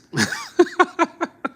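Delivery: sudden high-pitched laugh; real amused cackle. Real, not acted. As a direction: burst out cackling. Streamer laughing at the clip.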